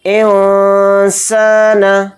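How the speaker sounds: इंसान with the n only nasalized, drawn out slowly; इंसान chanted with ikhfa; hidden nasal n. Shown as a demonstration. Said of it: "नून की आवाज़ में हम इख्फा करेंगे".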